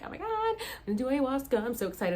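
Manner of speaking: bubbly quick gush; excited. Retold or imitated. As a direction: gush about it like a thrilled kid. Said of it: "I was so excited".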